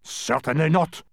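high, loud refusal; dodecahedron's upset outburst; indignant shout. A narrator's reading. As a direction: shout it down sharply. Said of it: "he shouted".

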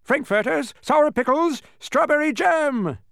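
very high, loud shout; shouting officer. A narrator's reading. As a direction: shout each dish out at top pitch. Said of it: "shouted Officer Shrift".

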